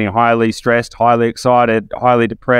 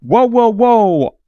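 high, anxious voice of someone clinging to safety; high panicky mock voice; scared stuck person; 11 semitones higher than usual. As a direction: squeal it out like a frightened person refusing to move. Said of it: "they go".

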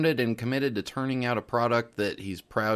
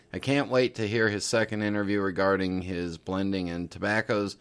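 usual speaking voice

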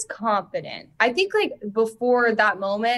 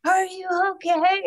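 worried crew; 10 semitones higher than usual. Retold or imitated. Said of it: "the cameramen being like".

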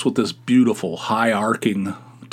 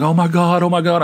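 higher, louder alarmed gasp of oh my God; retold panic; 6 semitones higher, 4 dB louder than usual. New paperboy sees the lady hit, mock alarmed. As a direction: replay the moment of horror, a bit hammed-up. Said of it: "I was like".

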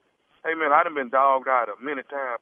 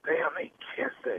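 breathless unvoiced whisper; whispering on the phone; 6 dB quieter than usual. Real, not acted. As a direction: whisper so they can't hear. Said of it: "All this whispering".

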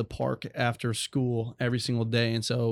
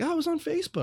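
much higher, breezy mock mentor; imitating mentor; faster than usual. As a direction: toss it off lightly in his voice. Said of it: "He's like".

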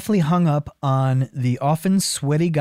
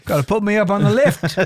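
mock voice; 5 semitones higher, faster than usual. Playing the car.

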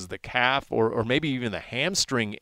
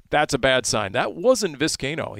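ordinary talk

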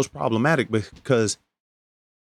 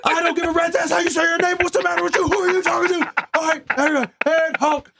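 high drill instructor yell. Retold or imitated. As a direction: bark orders like a drill sergeant from hell. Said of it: "he would talk like this".

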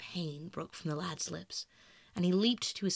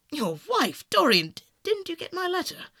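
loud, higher dismayed questioning; alarmed question; 8 semitones higher, 8 dB louder than usual. Acted. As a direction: stumble out in alarm. Henry shocked at the engagement.

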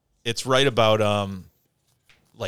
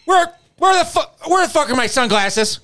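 high, loud frantic whine; imitating Corey; 7 dB louder than usual. Corey on the river, whining about his lost sunglasses, high frantic. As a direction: mimic a friend losing it over lost sunglasses. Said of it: "he's like".

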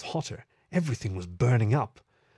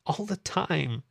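higher, mildly tearful voice; sniffling; 5 semitones higher, slower than usual. A narrator's reading. Meadow finishes her apology to Fib, tearful. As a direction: add it miserably, near tears. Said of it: "Meadow sniffled".

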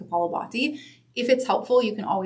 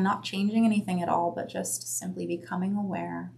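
guided meditation; 3 dB quieter than usual. Acted. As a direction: guide them gently inward. Leads a breath meditation.

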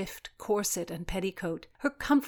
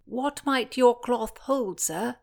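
a lightly voiced young boy, slow; a girl carefully trying to sound like a boy; lightly put-on boy voice; 6 semitones higher, 4 dB louder, slower than usual. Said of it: "trying out her boy voice".

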